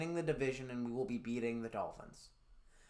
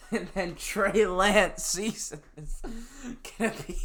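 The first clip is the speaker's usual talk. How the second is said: breaking into laughter mid-sentence; laughing; 7 semitones higher, 7 dB louder than usual. Real, not acted. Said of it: "I can't even say that with a straight face".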